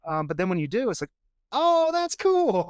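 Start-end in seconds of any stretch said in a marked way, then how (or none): 1.5-2.7 high, drawn-out "ooh" of delight; impressed realisation. Retold or imitated. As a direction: act out someone suddenly impressed. Retells admirers' delighted realisation.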